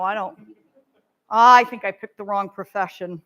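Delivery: low, deflated muttering to oneself; hammed-up hopeless resignation; discouraged teacher. Shown as a demonstration. Demonstrates teacher discouraged by class.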